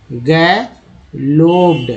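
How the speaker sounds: गैलोप्ड drawn out slowly, each syllable apart; teacher slowly sounding out गैलोप्ड. Shown as a demonstration. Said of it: "ईडी बोलते हैं तो गैलोप्ड हो गया".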